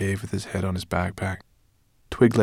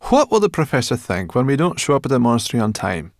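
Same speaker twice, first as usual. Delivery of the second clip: worried; 5 semitones higher, 7 dB louder, faster than usual. Acted. Sorrel worried, rushed complaint.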